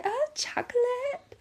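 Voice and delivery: put-on high, slow little-kid delight; excited toddler. Mom imitating her toddler excited about chocolate, high. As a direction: squeal it like a thrilled toddler. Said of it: "He got so excited".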